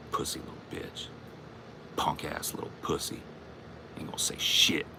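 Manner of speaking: breathy whispered taunts; sneering whisper; whispered insults. Real, not acted.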